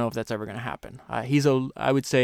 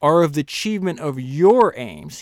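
your pushed louder in aims; stress on your; 4 semitones higher, 8 dB louder than usual. Shown as a demonstration. Host echoing the author's emphasis, stress on your. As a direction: press on the word your. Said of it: "He emphasized the word your again".

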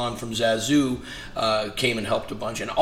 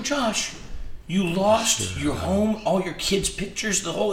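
high, slow, aghast at a friend's loss; stunned disbelief; 9 semitones higher, slower than usual. Retold or imitated. Telling the friend who lost his home, stunned disbelief. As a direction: let the disbelief stretch each word out.

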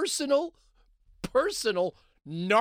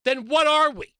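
raised, loud and high; loud outburst; 8 semitones higher, 6 dB louder than usual. Real, not acted. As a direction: demand it of them loudly. Host rants, rhetorical outburst.

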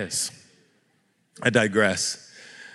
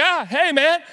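startled. Retold or imitated. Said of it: "I'm just like".

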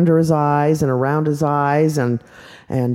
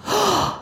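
high soft excited gasp; delighted gasp; 4 dB quieter than usual. Acted. Little girl gasping at being a beautiful witch.

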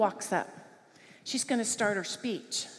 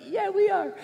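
cheerful yes; 10 semitones higher, 5 dB louder than usual. Retold or imitated. Aunt answering the kids.

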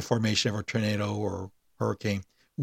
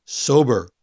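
"Sober" louder, as a pointed one-word addition; emphatic; 6 dB louder than usual. A narrator's reading. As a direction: punch "Sober" firmly on its own. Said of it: "she added for emphasis".

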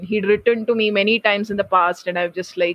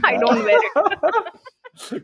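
laughing; 6 semitones higher, much slower than usual. Real, not acted. Laughing at goat joke.

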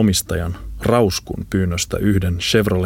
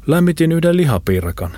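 much higher-pitched character voice; friend calling back; 9 semitones higher than usual. Acted. Friend answers Lambo from the kitchen, calling in a high voice. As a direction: answer in a high, sustained call.

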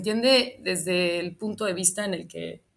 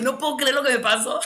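higher and louder, telling friends in disbelief; retold disbelief; 6 semitones higher, 5 dB louder than usual. Telling her friends what happened, in higher, louder disbelief. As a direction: burst out with the shocking news, retelling it. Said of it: "y les dije".

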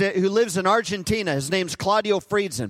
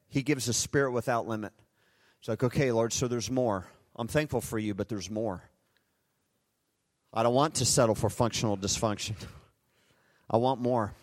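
much softer, lower, praying close and intimate; hushed prayer; 9 semitones lower, 7 dB quieter than usual. Acted. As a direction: pray it under your breath to the Lord.

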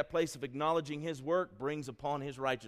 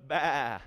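a louder spoken "Bah" like a sheep; sheep bleat; 5 dB louder than usual. Shown as a demonstration. Preacher cueing the congregation to bleat like sheep. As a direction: bleat it out with the crowd. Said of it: "But you ready?".

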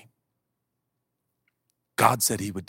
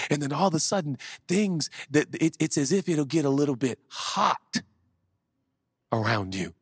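normal talking voice

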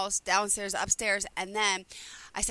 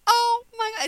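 high, loud retold "oh my God"; delighted disbelief, pitched high; amazed gasp; 13 semitones higher, 8 dB louder than usual. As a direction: relive the shock of waking to a toy on your head. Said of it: "I'm like".

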